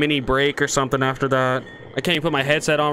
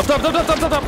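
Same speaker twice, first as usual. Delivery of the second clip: urgent callout; 12 semitones higher, faster than usual. Real, not acted.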